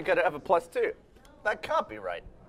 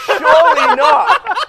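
loud, slow, higher laughing "Surely not"; laughing disbelief; 14 semitones higher, 11 dB louder, much slower than usual. Real, not acted. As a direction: cry it out through laughter, slowly. Disbelief at huge draw.

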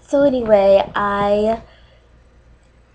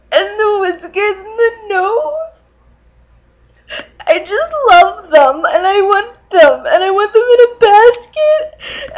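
higher, louder blubbering with laughing sobs; hammed-up sobbing; 6 semitones higher, 3 dB louder than usual. Dating video host melting down over cats, blubbering. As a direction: wail it out in a comic meltdown.

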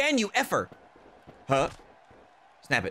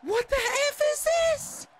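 high, slow disbelief; raging gamer. Real, not acted. Outrage at the game.

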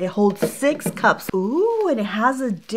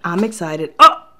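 usual speaking voice

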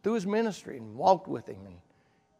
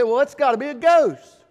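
disciples' fright; 6 semitones higher, 10 dB louder than usual. Retold or imitated. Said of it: "they take a look out there and they say".

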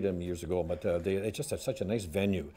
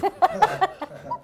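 loud high laugh; 5 dB louder than usual. Real, not acted. Laughs at own joke.

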